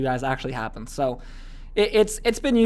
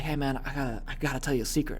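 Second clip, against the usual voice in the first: hushed secret; 3 semitones lower, 8 dB quieter than usual. Retold or imitated. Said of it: "then you whisper".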